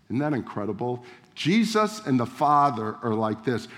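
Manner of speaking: ordinary delivery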